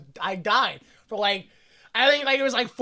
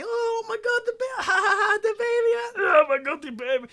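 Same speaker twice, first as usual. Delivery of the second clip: giddy laughing awe; 12 semitones higher than usual. Retold or imitated. Voicing Hess feeling kicks.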